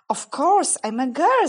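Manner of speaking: indignant surprise. A narrator's reading. Lucy protests the obvious.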